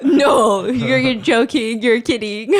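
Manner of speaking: loud, drawn-out mock disbelief; stunned imitation, loud and slow; disbelieving. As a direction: act out refusing to believe it. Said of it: "his face looks like".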